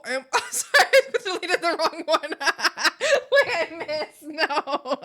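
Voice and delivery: high and loud, laughing through the words; breaking into high laughter mid-sentence; laughing apology. Real, not acted. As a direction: giggle helplessly while apologizing. Deleted wrong message, laughing.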